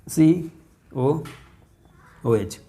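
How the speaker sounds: each letter drawn out slowly; slow spelled-out formula. Shown as a demonstration. Teacher spelling the formula slowly to the class. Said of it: "देखिए this one".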